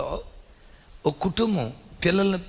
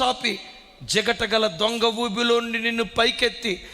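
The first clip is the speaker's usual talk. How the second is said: earnest pulpit prayer; prayerful blessing; 5 semitones higher than usual. Acted. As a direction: call down a blessing on the listener.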